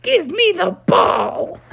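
tiny creature squeal, very high and drawn out; high-pitched baby-alien squeal; little squeal. Acted. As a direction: squeeze the words out in a slow squeak. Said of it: "sent out a little squeal".

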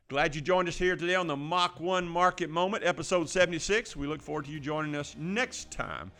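upbeat host outro; show sign-off. Acted. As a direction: announce the show close, bright and loud.

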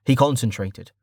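usual speaking voice

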